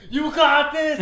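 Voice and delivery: high cheering yell; screaming. Retold or imitated. Game show guest screaming encouragement, high cheering yell. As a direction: yell it at the contestant. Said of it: "I start screaming".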